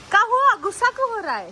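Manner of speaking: high-pitched coo, as to a little one; cooing to a child. Pretend. Asking a child why they are angry, high coo.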